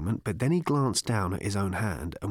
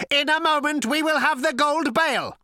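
high, loud robot declaration; cold, lifeless robot; 5 dB louder than usual. A narrator's reading. Robot announces its plan to Zaphod, coldly mechanical. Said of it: "cold, sleek, and lifeless".

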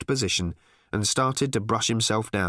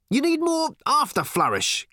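countering. A narrator's reading. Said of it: "countered Ford".

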